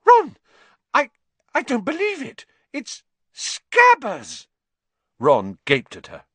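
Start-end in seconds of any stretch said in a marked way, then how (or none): 0.0-4.5 very high, loud, stunned cry; shrieking in utter surprise; shocked shriek. Acted. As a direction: gasp out the discovery in disbelief. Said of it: "she let out a shriek".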